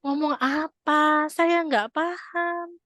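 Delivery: exasperated confusion, stretched out; baffled husband. Acted. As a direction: play a husband who can't follow at all. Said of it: "Sampai suaminya bilang gini".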